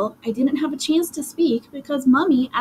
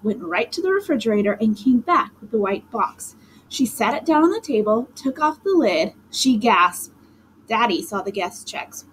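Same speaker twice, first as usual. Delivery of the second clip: plain reading voice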